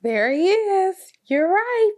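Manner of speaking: put-on high, bright cheer for a child; excited praise. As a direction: celebrate the child's answer. Praising child finding bear.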